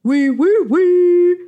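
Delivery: chasing baker. A narrator's reading.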